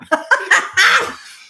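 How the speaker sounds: a big high burst of laughter. Real, not acted. Laughing hard at her own rude remark to a stranger. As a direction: laugh out loud at herself.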